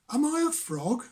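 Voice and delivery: high, small innocent voice; little creature voice. Acted. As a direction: ask like a wide-eyed baby bird. Beaky asks innocently.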